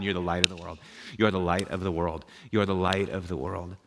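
low, soft, patient repetition; calm reassurance. Retold or imitated. Jesus repeating himself to the protesting pastor, calm reassurance. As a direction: say it softly and low, unmoved. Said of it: "Jesus said".

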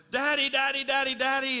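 put-on high-pitched little girl calling out Daddy; child hollering. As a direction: act out a frightened child calling for her father. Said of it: "she started hollering".

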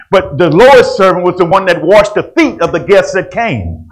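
loud, high, driving preacher's cry; fervent pulpit preaching, loud and high; preaching at full force. Acted.

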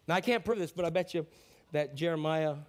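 ordinary talk, nothing marked

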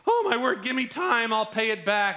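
high, loud panicked begging; pleading debtor. Retold or imitated. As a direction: beg for time in a high, frantic voice.

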